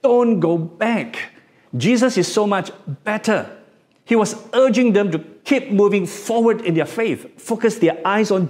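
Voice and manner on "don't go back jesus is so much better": pleading. Retold or imitated. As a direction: beg them not to turn back. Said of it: "passionately pleaded with them".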